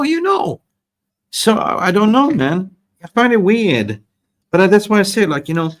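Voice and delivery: high, hammed-up worried footballer; bad Victor Osimhen impression. Striker doubting his agent's advice to wait, hammed-up high complaint. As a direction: whine in a badly faked striker voice, pitched well up. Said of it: "this bad Victor, uh, Osimhen impression".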